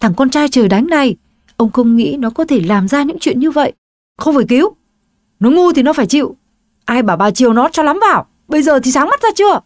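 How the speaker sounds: father blaming his wife, high and angry. Acted. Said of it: "ông Quang liền trở nên giận dữ".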